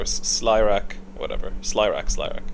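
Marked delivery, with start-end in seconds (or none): none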